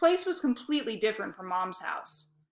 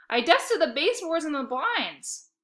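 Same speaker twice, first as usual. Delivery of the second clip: higher, calling out; yelled from hallway; 6 semitones higher, 3 dB louder than usual. A narrator's reading. Boy yelling to dad from the hallway. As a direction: call it down the hall. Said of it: "I yelled from the hallway".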